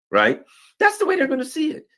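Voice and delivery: ordinary delivery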